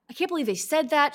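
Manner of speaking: high, stung "I can't believe they said that"; hurt disbelief. Retold or imitated. A stung listener mimicking her own hurt disbelief. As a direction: play the one who just got insulted. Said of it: "it's like she saw right through me".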